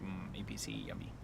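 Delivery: low whispered yummy; breathy craving whisper; hungry whisper. Retold or imitated. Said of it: "and I'm like".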